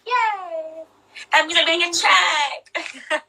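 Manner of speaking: sarcastic little cheer; mock cheer. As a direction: play it as cheeky glee about the money. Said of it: "I'm like".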